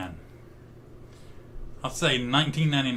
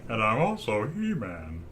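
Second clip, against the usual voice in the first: He-Man impression; 5 semitones lower, slower than usual. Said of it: "They sound nothing alike".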